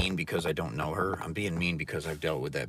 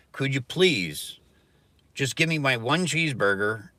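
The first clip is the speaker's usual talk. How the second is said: mock pleading; 5 semitones higher, 4 dB louder than usual. Pleading at drive-thru.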